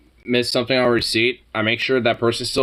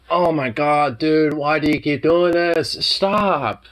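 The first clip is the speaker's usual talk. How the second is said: louder, slow, fed-up plea; exasperated; 5 semitones higher, 3 dB louder, slower than usual. Real, not acted. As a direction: drag it out, fed up. Dog keeps batting at him.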